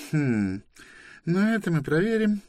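very high, put-on official voice; hum, then promise a check, high-pitched; sceptical inspector. Inspector doubts there is no cargo, sceptical.

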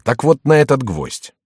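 indignant. Acted. Rival repeats the threat.

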